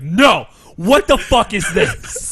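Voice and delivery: high, loud shout, words stretched out; hammed-up refusal to calm down; mock outrage. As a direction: imitate a friend's furious, baffled yelling. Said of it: "me being that angry at it".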